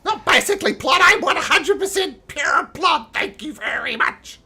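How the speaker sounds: high, loud offended goblin; indignant goblin. Acted. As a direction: bristle with goblin pride. Goblin insists on his identity.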